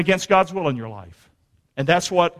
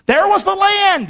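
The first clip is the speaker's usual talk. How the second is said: ordinary delivery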